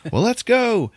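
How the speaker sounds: very high, loud call to action; mock rallying cry. Joker rallying villains to take the Batcave, high and loud. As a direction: send it up high like a cartoon charge.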